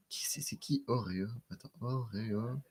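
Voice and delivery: hushed, puzzled self-talk; murmure pour soi. Real, not acted. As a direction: whisper to yourself while searching for a name. Se parle en cherchant un nom. (chuchotement perplexe, pour soi-même)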